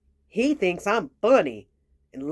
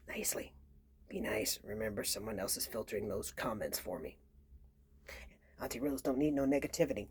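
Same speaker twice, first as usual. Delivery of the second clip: whispered plea; 10 semitones lower, 14 dB quieter than usual. Real, not acted.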